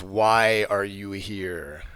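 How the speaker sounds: slow, low undead lich voice; a deep, dragged-out tomb voice; ancient rasping undead king. Acted. Lich questions the party.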